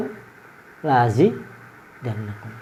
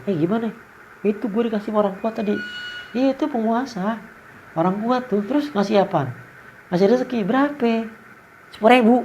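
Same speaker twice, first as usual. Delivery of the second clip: mock dialogue; 8 semitones higher, 4 dB quieter than usual. Imagined dialogue about the giver.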